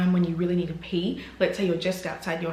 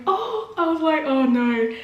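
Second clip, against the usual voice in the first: high, dismayed cry; 8 semitones higher, 3 dB louder, slower than usual. Retold or imitated. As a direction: let it rise in dismay. Retold dismay.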